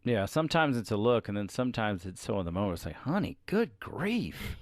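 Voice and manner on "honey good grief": high, slow, exasperated "honey"; drawn-out, fed-up sigh of a reply. Retold or imitated. Wife exasperated at husband's question.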